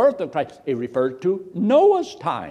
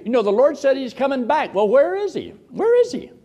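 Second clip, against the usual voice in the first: raised, high-pitched jeering challenge; mocking scoffers; 11 semitones higher, 4 dB louder than usual. Scoffers making fun of the Lord's promised return, mocking. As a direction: jeer it higher, building to a louder taunt. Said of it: "They're gonna mock and make fun".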